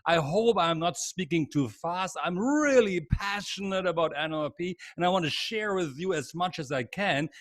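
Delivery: ordinary delivery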